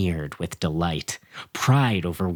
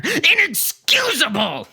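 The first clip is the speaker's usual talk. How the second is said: slow, high, loud unhinged shout; deranged yell; insane shout; 4 dB louder than usual. Acted. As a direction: shout it like a madman. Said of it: "I shouted insanely".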